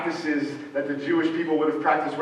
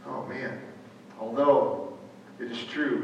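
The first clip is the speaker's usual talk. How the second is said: deflated remembering; 4 semitones lower, 6 dB quieter than usual. Retold or imitated. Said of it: "He remembers".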